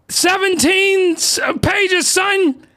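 high, loud shouting about seventeen pages; too loud. Real, not acted. Reviewer yelling to viewers about his notes, in a high loud shout. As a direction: blow out their eardrums with excitement. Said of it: "I feel like I'm blowing out your eardrums".

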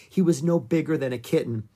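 ordinary delivery, nothing marked

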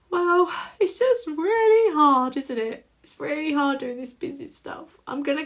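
half-crying mock lament; tearful whine. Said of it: "I was like".

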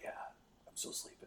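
faint, sleepy whisper; just-woken voice. Retold or imitated. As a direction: barely get the words out, half asleep. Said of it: "did I wake you up".

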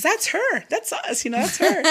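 excited, high-pitched insisting; mock an excited friend pointing someone out; retold excited insistence. Retells friend insisting to vendor.

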